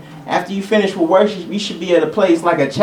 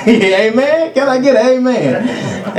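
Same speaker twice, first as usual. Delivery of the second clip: higher, laughing call for response; 7 semitones higher, slower than usual. Real, not acted. Preacher asking the congregation for amen, laughing. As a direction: coax the room through laughter.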